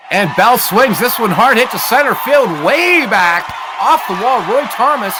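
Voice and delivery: high, loud home-run-style call; excited shout. Acted. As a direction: shout it out as the ball heads for the wall. Big hit to the wall.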